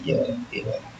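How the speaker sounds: ये वाला, ए वाला whispered under breath; teacher whispers a self-correction; whispered aside. Shown as a demonstration. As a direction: whisper it to yourself. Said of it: "ये तो ए वाला sound है".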